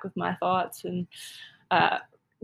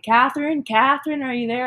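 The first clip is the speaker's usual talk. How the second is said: louder, higher call down the line; 5 semitones higher, 6 dB louder than usual. Retold or imitated. Operator calling out to the lost hiker, louder and higher. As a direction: call her name to reach her.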